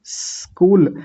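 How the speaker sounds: स्कूल opening on a hissed s, no i; correct स्कूल. Shown as a demonstration. Teacher answering how we say it, pure स्कूल. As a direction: begin स्कूल right on the s. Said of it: "तो ई नहीं लगाएंगे".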